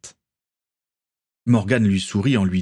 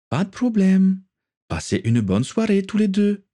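higher, bright cheerful send-off; lightly voiced Natalia; 11 semitones higher than usual. A narrator's reading. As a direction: wish them a good evening, cheerfully.

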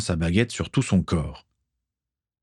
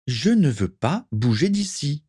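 objecting; 7 semitones higher than usual. A narrator's reading. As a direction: object firmly in a raised pitch. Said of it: "contra Akiko". (contestation, voix plus aiguë)

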